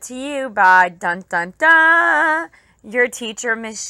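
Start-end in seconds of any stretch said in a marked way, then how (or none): none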